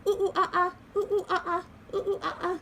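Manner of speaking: high hooting monkey calls. Pretend. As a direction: hoot like a playful monkey. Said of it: "I'll do a monkey".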